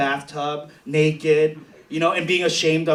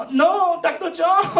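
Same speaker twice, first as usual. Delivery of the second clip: put-on much higher, slow girlish whine; mock coy plea; 13 semitones higher, slower than usual. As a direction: tease him with a damsel voice. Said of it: "I'll just be like".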